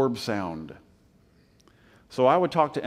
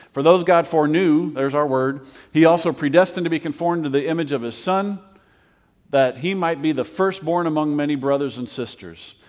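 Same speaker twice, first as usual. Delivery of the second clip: rising, louder preacher's build; preaching at full force; 3 semitones higher, 5 dB louder than usual. Acted. Pastor to his congregation, preaching hard and loud. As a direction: drive the verses home, voice climbing.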